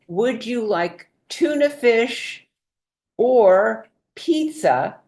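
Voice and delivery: slow, clear choice between two; patient slow question to a parent; slow simple question. Shown as a demonstration. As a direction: offer the two options slowly. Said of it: "as an example".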